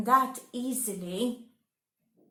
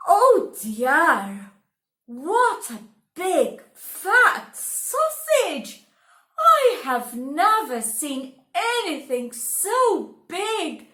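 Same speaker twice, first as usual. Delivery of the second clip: higher, louder amazed exclaim; amazed wife; 5 semitones higher, 5 dB louder than usual. Acted. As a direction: marvel at the giant sausage.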